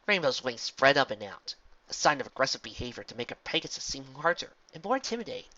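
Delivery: plain reading voice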